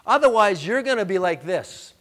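loud, rising pulpit preaching; full-force preaching. Acted. Pastor preaching God's sovereignty at full force. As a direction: build it up to the church.

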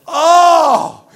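loud, high startled "Oh!"; whole crowd gasping aloud; shocked gasp. Retold or imitated. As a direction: react like the puck just hit him. Crowd reacts to puck hitting head.

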